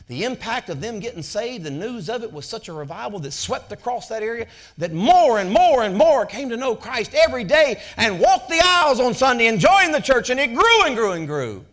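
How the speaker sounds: building to a loud, high preacher's cry; rising preaching climax. Acted. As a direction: lift them to the revival peak.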